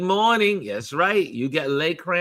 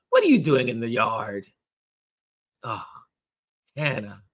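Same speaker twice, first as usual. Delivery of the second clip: usual speaking voice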